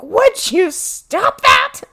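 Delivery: slow, high, loud scream. Retold or imitated. Leo cracking at Bob at dinner, slow high scream. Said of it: "he eventually just screams".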